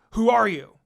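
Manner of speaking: loud, sharp demand; loud angry demand; demanding shout. Acted. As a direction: bark the question at her. Sasuke demands again.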